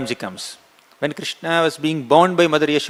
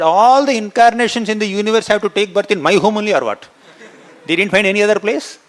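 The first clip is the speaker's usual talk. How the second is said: indignant scolding; 6 semitones higher, 4 dB louder than usual. A narrator's reading. Mother scolds Balram indignantly.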